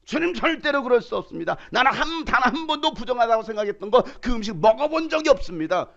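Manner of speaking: high, cocksure protest; smug refusal to God; self-assured Peter. Acted. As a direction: boast like a know-it-all. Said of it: "얼마나 자신만만합니까".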